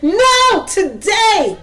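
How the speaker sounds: a hammed-up insistent wife; insistent high exclamation. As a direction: cut him off with an urgent today.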